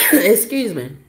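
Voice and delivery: ordinary delivery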